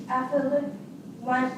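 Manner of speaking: normal talking voice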